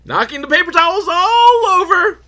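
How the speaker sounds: very high, loud comic wail; mock-wailing outburst. Real, not acted. Telling how the display got knocked over, high loud mock wail. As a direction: send it way up high and hold it.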